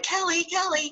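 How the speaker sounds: high, soft singsong calling Kelly Kelly. Retold or imitated. [name] to her upline, singsong call for Kelly. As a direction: sing her name asking for help. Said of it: "I'm like".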